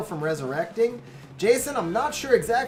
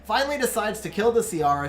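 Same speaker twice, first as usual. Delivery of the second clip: ordinary delivery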